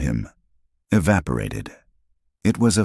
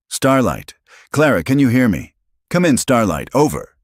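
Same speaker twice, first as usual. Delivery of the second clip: higher and louder frantic shout; desperate radio call; 8 semitones higher, 5 dB louder than usual. A narrator's reading. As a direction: call out, panicked and urgent. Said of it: "His voice cracked on her name".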